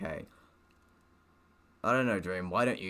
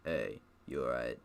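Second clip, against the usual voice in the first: a low, soft deadpan corpse voice for Dream; 9 semitones lower, 5 dB quieter than usual. Acted. Reading Dream's line to the reader in a low corpse voice. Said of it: "giving him a corpse voice".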